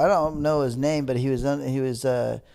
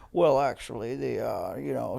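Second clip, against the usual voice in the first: lightly imitated British announcer, pompous; a hint of a pompous British accent; 4 semitones lower, 4 dB quieter than usual. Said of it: "this British guy that was like".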